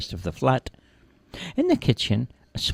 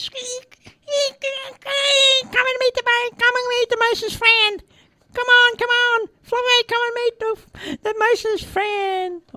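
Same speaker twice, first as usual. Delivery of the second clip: tiny mouse calling out, extremely high; high-pitched squeals; slower than usual. A narrator's reading. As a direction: squeal excitedly to gather the mouse family. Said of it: "with a few high-pitched squeals".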